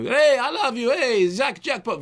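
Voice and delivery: high, loud, excitable shout; Roberto Benigni. Retold or imitated. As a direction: gush loudly in a high pitch. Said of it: "Roberto Benigni".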